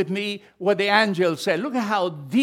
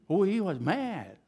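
normal talking voice

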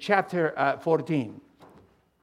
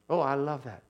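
normal talking voice

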